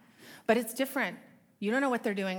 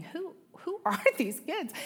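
amazed; 8 semitones higher than usual. Retold or imitated. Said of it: "And I'm thinking".

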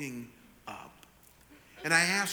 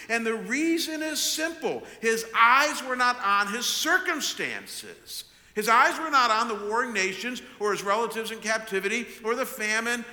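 loud, raised, emphatic sermon delivery; forceful preaching; 6 semitones higher, 6 dB louder than usual. Acted. Preacher urging congregation that Abraham kept his hope, forceful. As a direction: preach it out in high, weighty phrases.

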